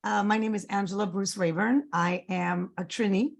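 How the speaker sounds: ordinary talk, nothing marked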